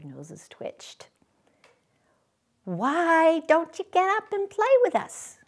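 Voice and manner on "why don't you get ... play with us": high, playful wild rabbit voice; wild rabbit asking. A narrator's reading. Wild rabbit inviting the toy rabbit, high and playful. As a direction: coax him to come and play. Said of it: "one of them asked".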